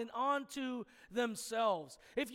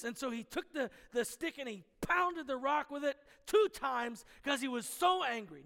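ordinary delivery

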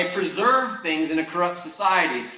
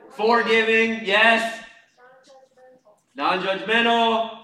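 loud, raised, affirming echo; calling out answers; 6 semitones higher, 7 dB louder, slower than usual. Real, not acted. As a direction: ring each word out to the room. Calls out audience answers loudly.

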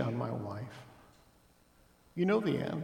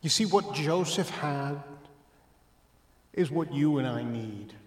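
ordinary delivery

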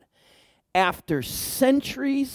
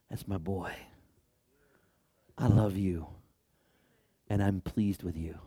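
hushed loving praise to a son; tender father; 9 semitones lower, 10 dB quieter than usual. Pretend. God speaks to his son at baptism, soft and tender. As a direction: softly, lower and gentle, like a proud father. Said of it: "The first thing God says".

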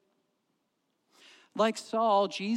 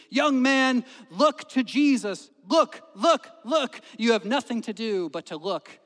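high, full-voiced revivalist shout; preacher's shout; 6 semitones higher, 10 dB louder than usual. Methodist lay preacher to young man, hands lifted, shouting. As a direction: shout the urgent plea from the pulpit. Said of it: "he shouted, only as primitive Methodist-, Methodists could do".

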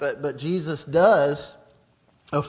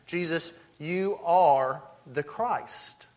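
slow, weighty, each word set apart; emphatic declaration; much slower than usual. Retold or imitated. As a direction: declare it deliberately, leaning on ARE. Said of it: "He is emphatically saying".

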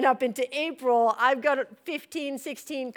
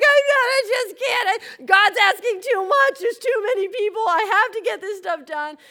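loud, high wailing complaint; hammed-up hysterical plea to husband; freaking out; 10 semitones higher, 8 dB louder than usual. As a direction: cry it out high and overwhelmed. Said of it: "freaking out".

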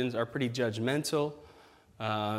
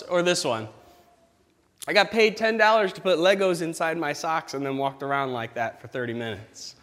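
usual speaking voice

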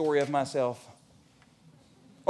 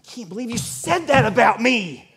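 hammed-up indignant pout, louder and higher; mock pout; 6 semitones higher, 4 dB louder than usual. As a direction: pout like an offended child. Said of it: "And I would pout".

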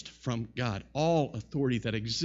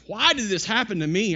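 pitched-up mock complaint; frustrated whining; 6 semitones higher, 5 dB louder than usual. Frustrated Job questioning his suffering, whining. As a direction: whine it as an aggrieved sufferer, louder. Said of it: "Job has been whining. He's been frustrated".

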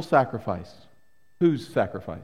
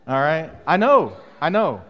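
usual speaking voice

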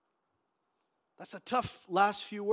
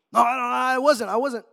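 loud, higher-pitched protest as Peter; panicked denial; 5 semitones higher, 11 dB louder than usual. Acted. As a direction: blurt out a forceful denial. Peter hurriedly denying knowing Jesus.

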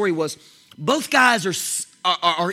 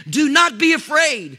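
louder emphatic; 6 semitones higher, 4 dB louder than usual. Real, not acted. Preacher drives the promise home to his listeners, louder. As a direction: Do not be afraid pushed high and louder. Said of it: "listen to what I just said".

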